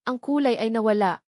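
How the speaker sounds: ordinary delivery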